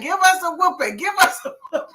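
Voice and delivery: high, rising mock plea from kids; begging kids. Kids beg uncle for a whooping instead, high mock plea. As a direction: beg as if a whooping is the lesser evil.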